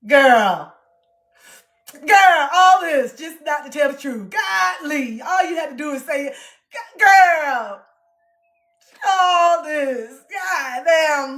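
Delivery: high, loud sassy scolding; exasperated sassy girl. Pretend. To her past self, loud exasperated sass. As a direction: scold yourself with big sass. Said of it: "you're just like this".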